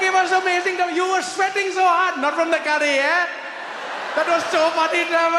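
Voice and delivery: put-on very high, loud, gloating Indian accent. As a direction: crow over a prank in an Indian accent. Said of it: "you start talking with accent".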